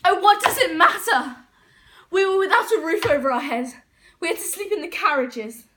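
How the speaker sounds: loud, high cry of dismissal; acted outburst. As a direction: throw it out loudly, pitch jumping up. Outburst over the family's ruin.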